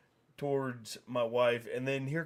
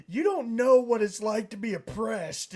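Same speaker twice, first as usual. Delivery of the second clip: high, louder imitation of an aggrieved woman; mock outraged protest; 9 semitones higher, 5 dB louder than usual. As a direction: mimic her protest, pitched up. Retold woman protesting in the quarrel.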